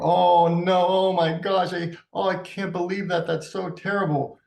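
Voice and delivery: high, hammed-up gasping distress; over-the-top shocked sympathy; overwrought dismay. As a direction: parent overreacting, hammed-up horror. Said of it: "reacting and saying".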